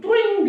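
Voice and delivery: high, ringing "twing"; imitated magical twing. Preacher shows the Lord popping up among disciples, twing. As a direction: make a sudden-appearance sound effect.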